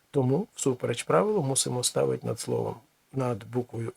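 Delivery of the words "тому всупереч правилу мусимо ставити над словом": ordinary delivery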